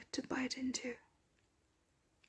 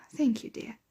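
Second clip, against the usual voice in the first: usual speaking voice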